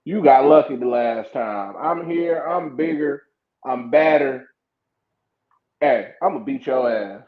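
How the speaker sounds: low-pitched, measured menace; wrestler's promo threat. Retold or imitated. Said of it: "He came out there and said".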